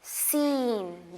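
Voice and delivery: सीन stretched very slowly; slow, dragged सीन; long ee. Shown as a demonstration. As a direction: hold the ee in सीन right out. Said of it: "long sound आप करते हैं".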